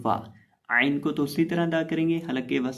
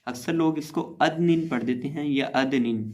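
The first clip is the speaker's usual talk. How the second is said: ordinary talk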